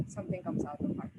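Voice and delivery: Something comes out of heart said on a breathy, unvoiced, moved hush; emotional. Real, not acted. Panelist moved by her own point, emotional hush. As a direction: let the feeling catch the words. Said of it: "Oh my gosh, I'm getting emotional".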